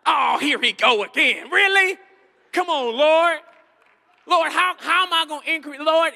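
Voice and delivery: loud, whiny complaining churchgoer; hammed-up protest to the Lord; mock protest. Preacher playing a churchgoer protesting loudly to God.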